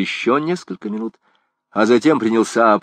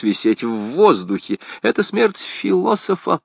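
lilting, higher-pitched musing on death; rambling philosopher; 5 semitones higher, 3 dB louder than usual. Acted. Gringoire reasons with the priest about hanging, wordy philosophical musing. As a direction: philosophise aloud with rhetorical swoops.

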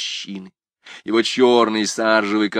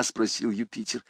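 ordinary delivery

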